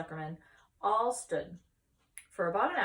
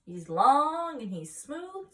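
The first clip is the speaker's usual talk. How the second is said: slow proud boast; 8 semitones higher, 9 dB louder, much slower than usual. Acted. Farmer boasting about pig.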